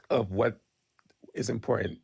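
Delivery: usual speaking voice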